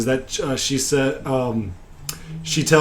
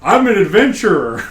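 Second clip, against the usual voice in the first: higher, lightly voiced hero; pitched-up character reply; adventurer's announcement; 6 semitones higher, 4 dB louder than usual. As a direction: announce yourself with mock pride. Player's answer in retold scene.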